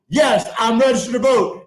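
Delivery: usual speaking voice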